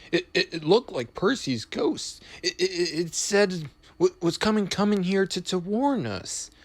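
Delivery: high, stammering, frightened engine voice; shaking voice. A narrator's reading. Toby tells Thomas he's seen a ghost, stammering in fright. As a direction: stutter it out, too scared to finish a word. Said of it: "said Toby in a shaking voice".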